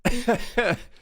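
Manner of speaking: sudden high laugh; high burst of laughter. Real, not acted. Laughs at DMCA story.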